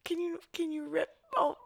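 very high, thin, soft squeak; Josie voice pitched absurdly high. Acted. Woman asking her lover to undress her, absurdly high squeak. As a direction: squeal the plea until barely audible. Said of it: "Only dogs can hear us now".

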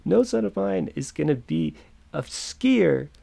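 drawn-out playful father's proclamation; mock unaccepting father. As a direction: imitate dad hamming up his disapproval. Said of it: "acting like this, um, unaccepting father".

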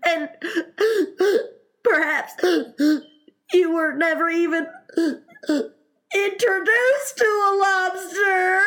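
high, loud wailing between sobs; choked with sobs. Acted. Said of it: "sobs choked his voice".

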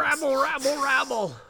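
high-pitched cartoon mob clamour; mock-angry crowd chant, high voice; angry South Park mob. Joking as the angry mob from South Park, mock-outraged. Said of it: "the angry mob from South Park".

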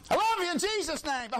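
high, hollered mock affection; put-on high-pitched loving shout; mock blessing. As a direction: call it out high through gleeful mockery. Mock greeting while driving at churches.

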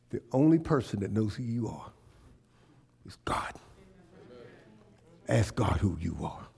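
soft low intimate sermon voice; the preacher drops to a near whisper; hushed preaching. Real, not acted. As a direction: draw them in, quietly.

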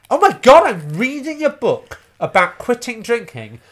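loud, high burst of disbelief; aghast exclamation. Retold or imitated. Reader finds own joke in quit-drinking book, aghast. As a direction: exclaim it in mock horror, dragging the words. Aghast at finding himself quoted.

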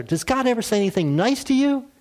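high, lightly voiced pastor; a hint of weary pastor; exasperated pastor. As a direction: pastor finally asks the gloomy lady, exasperated. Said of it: "the pastor just asked her".

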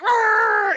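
sudden high, loud imitated dog bark. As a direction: bark it out like an angry little dog. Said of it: "Oki's like".

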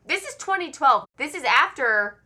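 high, loud punch on "twenty twelve"; emphatic insistence. Real, not acted. Host cutting through co-host's joke, emphatic stress on "twenty twelve". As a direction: insist on "twenty twelve" with a big pitch swoop.

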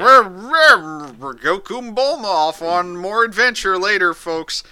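hammed-up episode narrator voice; show narrator. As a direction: announce it big, then ease off on the sign-off. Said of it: "we get the narrator".

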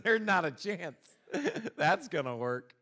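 laughing through the words; can't hold back a laugh mid-sentence; chuckling in disbelief at the guard. Real, not acted. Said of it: "I just started laughing".

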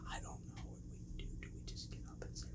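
rapid, barely audible whisper; anxious mock whisper. Kid in truck bed consulting friend, urgent acted whisper. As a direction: confer secretly so nobody hears. Hidden kids deciding what to do.

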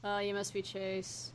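quiet, slow, understated park ranger. Acted. Park ranger meeting Chase, understated movie-style voice. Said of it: "I'll do the park ranger from Yogi Bear".

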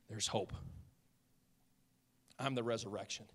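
very soft, low and unhurried; hushed assurance. Retold or imitated. Jesus speaking into the mourners' mayhem, hushed assurance. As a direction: voice Jesus quietly, pausing between phrases.

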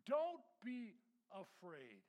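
Jesus calling out to the terrified disciples; firm reassurance. Acted. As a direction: call it out firmly to calm them. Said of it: "He spoke to them at once".

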